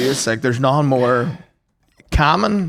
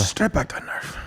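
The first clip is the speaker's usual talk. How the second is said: whispered repeat, much softer; whispered echo; 8 dB quieter than usual. Real, not acted. Echoing his friend's line, whispered echo. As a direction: breathe the phrase back.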